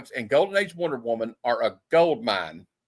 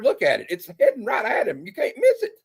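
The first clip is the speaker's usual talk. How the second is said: comic exasperation; 11 semitones higher, 3 dB louder than usual. Real, not acted. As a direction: voice climbing into a high squeak. Mock exasperation over obvious warning.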